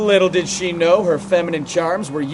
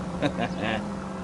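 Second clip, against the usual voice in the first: quiet amused chuckle; a short low laugh; soft chuckle; 4 semitones lower, 11 dB quieter than usual. Real, not acted. Partner laughs at own joke.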